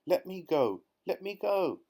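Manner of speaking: high, loud squealing yell; crocodile yelling. Acted. Crocodile dangling upside down yells to be freed. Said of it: "yelled the enormous crocodile".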